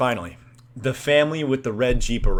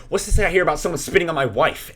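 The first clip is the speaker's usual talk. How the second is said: higher, louder, rapid demand; mock confrontational; 7 semitones higher, 5 dB louder, faster than usual. Husband confronting a teen about spitting, mock indignant. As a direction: confront them as an offended husband. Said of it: "he's, like, kinda confronting us".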